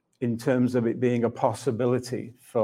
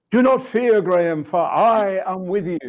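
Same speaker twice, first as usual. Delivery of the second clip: hammed-up declaration, high and loud; theatrical; 6 semitones higher, 4 dB louder than usual. Believer told to say the verse like he believes it, theatrical. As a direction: declaim it grandly like a stage actor. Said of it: "I got all theatrical".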